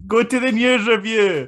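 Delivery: punchy shouted promo; loud plug. Real, not acted. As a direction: call out the plug, loud and high. Jokey plug of own show.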